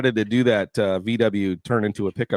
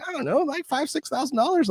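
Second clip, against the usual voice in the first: buddy's voice pitched way up, casual; imitating the buddy; 3 dB quieter than usual. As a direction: toss off the price lightly, high. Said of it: "And he's like".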